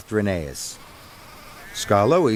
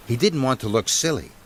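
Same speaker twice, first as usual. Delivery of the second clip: usual speaking voice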